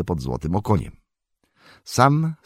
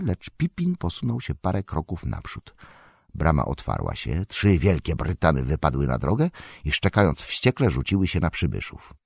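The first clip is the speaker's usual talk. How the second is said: ordinary delivery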